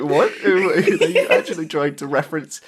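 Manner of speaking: loud, laughing incredulous question; half-laughing what-are-you-doing; laughing in amused disbelief. Retold or imitated. Incredulous retold reaction, laughing.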